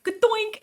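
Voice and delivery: high loud cartoon bonk; high, loud comic sound effect; comic impact noise. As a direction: shout the hit like a cartoon. Sound of ice hitting her.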